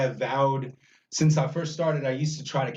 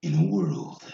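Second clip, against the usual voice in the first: deep, booming trailer announcer; movie trailer voice; 8 semitones lower than usual. Shown as a demonstration. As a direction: growl it like an epic trailer. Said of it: "Go ahead, do it like as if you're on the phone".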